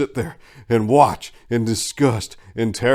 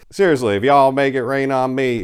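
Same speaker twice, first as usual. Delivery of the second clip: ordinary delivery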